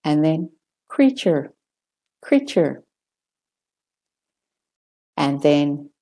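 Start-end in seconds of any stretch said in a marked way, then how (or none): 0.9-2.8 "creature" with American r ending, falling. Shown as a demonstration. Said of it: "British and Australian first".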